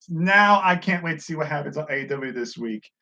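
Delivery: ordinary talk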